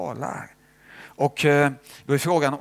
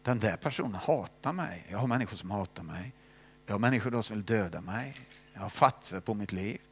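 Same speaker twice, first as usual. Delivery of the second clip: softer, lower, pouring out troubles; a softly voiced lament in prayer; quiet complaining prayer; 4 semitones lower, 5 dB quieter than usual. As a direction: confide your hurts to Jesus, hushed. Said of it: "Jag säger så här".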